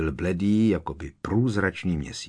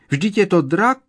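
much higher, loud squeal of panic; panicked squeal; 11 semitones higher, 7 dB louder than usual. A narrator's reading. Frightened first-year girl at the sky, panicked squeal. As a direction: shriek as if she's lost her head. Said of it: "vyjekla jedna prvňačka".

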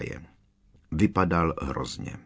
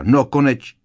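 louder, relieved burst; blurted out; 5 semitones higher, 7 dB louder than usual. A narrator's reading. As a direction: blurt it out the moment the door opens. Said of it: "Hermiona vyhrkla". (vyhrknuté, hlasitější)

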